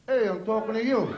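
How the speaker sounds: put-on tough-guy Brooklyn accent. Brooklyn guy accosting the comic, thick New York accent. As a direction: confront someone in a Brooklyn accent. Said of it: "He actually spoke to me like this".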